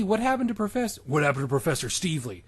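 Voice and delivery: ordinary delivery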